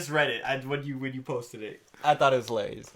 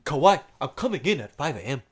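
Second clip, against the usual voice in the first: bad African accent; 3 semitones lower, 9 dB quieter than usual. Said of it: "I can't even do a good African accent".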